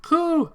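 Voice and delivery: loud, high pigeon coo. Acted. Pigeon Oko-san cries out.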